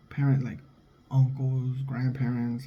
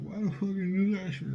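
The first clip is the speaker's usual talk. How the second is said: higher mock-indignant relative voice; 6 semitones higher, 5 dB quieter than usual. Relative demanding why he hit nephew, mock indignant. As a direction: pitch it up, play the offended relative. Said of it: "when you're sitting there like".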